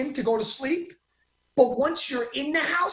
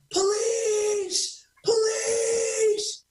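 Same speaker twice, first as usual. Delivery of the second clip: much higher, drawn-out calls for help; mock cry for police; 11 semitones higher, much slower than usual. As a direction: imitate a wounded man crying out. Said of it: "I could have said".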